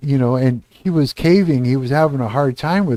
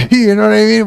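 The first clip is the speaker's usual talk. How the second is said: louder, higher laughing aside; 8 semitones higher, 5 dB louder, faster than usual. Real, not acted. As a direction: laugh it off in disbelief. Laughs in disbelief at his own story.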